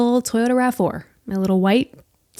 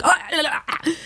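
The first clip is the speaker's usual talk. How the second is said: panicked gasps; 8 semitones higher, 6 dB quieter than usual. Retold or imitated. Panic over a serious injury.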